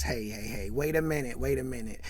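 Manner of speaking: low, soft voice cutting in; low-key interjection. Retold or imitated. Man stopping his friends mid-argument, low-key interjection. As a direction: quietly halt the friends' talk.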